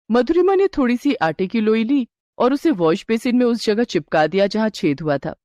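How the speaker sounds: ordinary delivery, nothing marked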